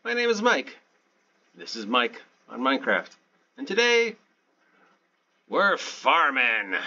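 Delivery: over-the-top cheery show opener; goofy announcer voice. Pretend. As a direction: welcome the viewers like a hammy TV presenter. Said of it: "I don't know why I'm talking like this".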